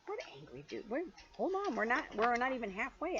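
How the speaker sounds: ordinary delivery, nothing marked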